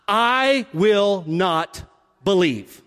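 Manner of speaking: very slow, deliberate refusal. Retold or imitated. Doubting Thomas refusing to believe, very slow. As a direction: drag out each word of the refusal. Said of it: "He says".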